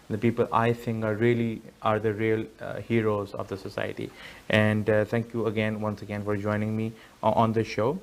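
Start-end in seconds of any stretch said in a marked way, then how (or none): none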